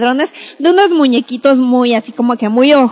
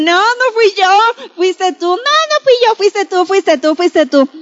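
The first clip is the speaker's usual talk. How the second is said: high-pitched denial turning to repeated accusation; squabbling child; 6 semitones higher than usual. Acted. Two children fight over who did it, accusing. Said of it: "Y el otro le dice".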